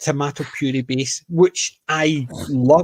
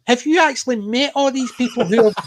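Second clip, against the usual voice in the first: higher, mocking disbelief at a silly idea; incredulous inner voice; 7 semitones higher than usual. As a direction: ask it like the answer is obvious. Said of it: "you're sitting there thinking".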